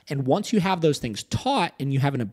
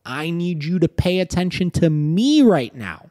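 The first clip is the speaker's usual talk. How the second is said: firm command; 5 semitones higher, 7 dB louder than usual. Retold or imitated. Owner firmly addressing the dog.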